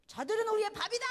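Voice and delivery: acted battle cry, high and very loud; loud, high-pitched defiant yell; angry bold shout. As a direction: shout it out fast as a fired-up hero defying doubters. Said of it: "더 큰 소리를 말했을 거예요".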